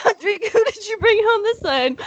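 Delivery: dad line cracking with laughter; high laughing dad voice. Acted. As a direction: redo it high, laughing through.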